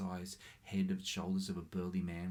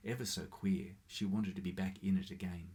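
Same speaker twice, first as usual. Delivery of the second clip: usual speaking voice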